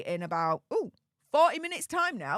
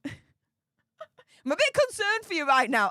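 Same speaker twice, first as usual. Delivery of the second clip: laughing; 4 semitones higher, 5 dB louder, slower than usual. Real, not acted. Teasing a viewer's odd question.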